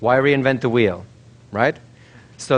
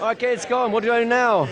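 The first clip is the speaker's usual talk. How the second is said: high-pitched, easing pretend panic; lingering mock panic; 9 semitones higher than usual. Pretend subject asking the hypnotist what to do now, lingering mock panic. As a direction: still high, but starting to settle.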